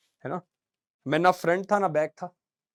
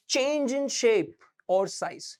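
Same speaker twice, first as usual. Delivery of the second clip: slow dictation; 3 semitones higher, much slower than usual. Shown as a demonstration. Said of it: "strain समझ गए ना".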